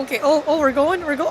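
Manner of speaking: normal talking voice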